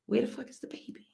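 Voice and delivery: whispered urgent aside on stage; hushed question. Retold or imitated. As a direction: hiss it under your breath.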